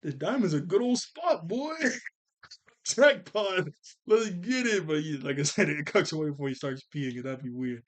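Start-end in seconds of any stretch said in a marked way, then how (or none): 0.0-4.9 dog voice. Acted. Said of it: "He's like".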